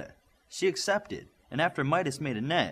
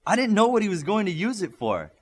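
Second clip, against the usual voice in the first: high, louder pleading protest; 8 semitones higher, 5 dB louder than usual. A narrator's reading. Midas pleads with the girl over the bucket, high. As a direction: plead your innocence. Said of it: "Midas pleaded".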